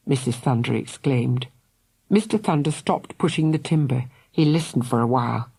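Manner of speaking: plain reading voice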